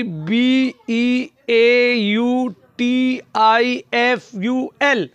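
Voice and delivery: B E A U T I F U L letter by letter; each letter named separately, B to L; spelled out. Shown as a demonstration. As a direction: spell beautiful aloud, drawing out each letter. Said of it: "तो spelling होती है".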